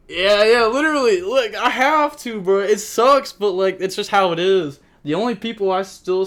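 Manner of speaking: high, loud, resigned ranting; exasperated venting. Real, not acted. Streamer agrees with chatter about Master Duel, loud exasperated rant. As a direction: vent that it sucks but that's life.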